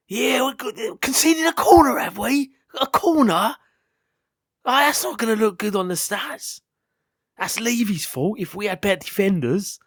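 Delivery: high-pitched whingeing football fan with a Star Wars baddie sound; moaning fan impression. On request, impersonating fans bitching about a conceded corner, high moaning voice. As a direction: put on a high, complaining baddie voice. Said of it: "sounds like one of the baddies in Star Wars".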